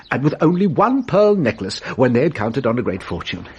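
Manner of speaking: ordinary talk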